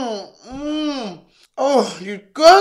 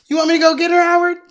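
taunting; 10 semitones higher, 5 dB louder, much faster than usual. Acted. Acted scene, taunting.

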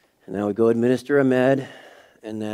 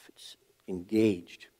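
slow, breathy whispered emphasis; emphatic whisper; 3 semitones lower, 6 dB quieter, much slower than usual. Real, not acted. Pastor stressing fellowship to church, slow whisper. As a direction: hush it for weight.

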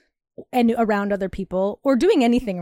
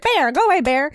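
higher and louder, shooing a bear away; mock shout at a bear; 5 semitones higher, 4 dB louder than usual. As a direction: yell at a bear to get lost, half-joking.

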